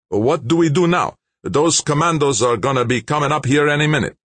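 high, loud, agitated voice; alarmed gunman. A narrator's reading. Gunman fears commandos coming, high and alarmed. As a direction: blurt out the worry, pitched high. Worried gunman asks partner.

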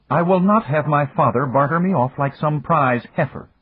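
put-on high, indignant young woman; angry. A narrator's reading. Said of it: "Lucita said angrily".

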